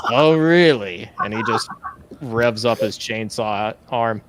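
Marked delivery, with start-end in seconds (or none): 0.1-1.1 louder, higher comeback; mock-incredulous villain; challenged gang leader. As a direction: rise to the taunt with theatrical disbelief. Gang leader reacts to jeering.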